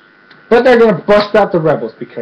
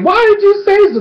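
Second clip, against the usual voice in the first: much higher excited squeal; delighted exclaim. Retold or imitated. Rich guy thrilled Korra wants a plane, high squeal. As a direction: squeal it like a giddy host.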